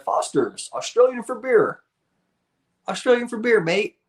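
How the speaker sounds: "Foster's, Australian for beer" read like a high TV ad announcer with a put-on Aussie twang. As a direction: mimic the old beer commercial, pitched high. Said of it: "I remember those commercials".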